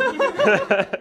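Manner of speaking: a burst of high laughter; loud laugh. Real, not acted. Laughs at her own hesitation.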